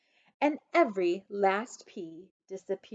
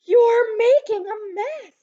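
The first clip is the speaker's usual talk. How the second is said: mother shouting; 11 dB louder than usual. A narrator's reading.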